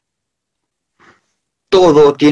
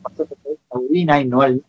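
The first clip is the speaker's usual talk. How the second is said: glitching audio; 5 dB quieter than usual. Real, not acted. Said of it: "se cortó un poquito el audio ahí".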